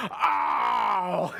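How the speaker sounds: hammed-up wounded "ow"; drawn-out mock wail; pained cry. Pastor friend stung by overheard remark, mock pained cry. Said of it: "And she was like".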